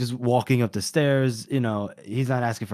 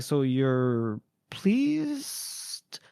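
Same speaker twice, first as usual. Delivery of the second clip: wary question; 6 dB quieter, much slower than usual. Retold or imitated. Glocta voiced wary, retold.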